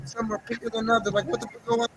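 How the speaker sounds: normal talking voice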